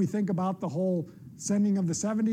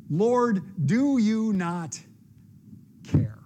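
louder, slow, drawn-out demand; exasperated warning; 3 semitones higher, 4 dB louder, much slower than usual. Acted. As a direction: make him answer for it. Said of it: "that same tone was very likely present in Martha's voice".

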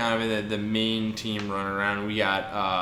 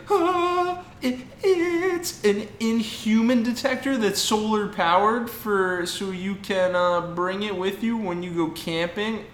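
put-on high, sing-song nerdy kid voice, much higher; 9 semitones higher than usual. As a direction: chirp it out like an eager science fair kid. Kid voiced presenting science fair.

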